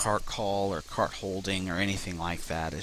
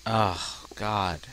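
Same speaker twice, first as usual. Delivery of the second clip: normal talking voice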